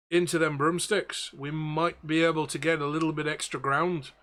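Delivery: ship's captain with a lilting mock Belfast; captain's Belfast accent, poorly put on; bad Belfast accent. Captain planning the escape with the wizard, bad Belfast accent. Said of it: "absolute worst Belfast accent I've ever done".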